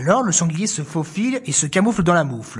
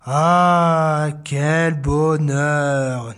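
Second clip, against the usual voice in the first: low, very slow blissful sigh; contented sigh; 3 semitones lower, much slower than usual. Acted. Animals sighing together in the mitten, slow and low. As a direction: savour it. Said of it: "disent-ils en chœur".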